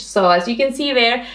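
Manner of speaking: ordinary delivery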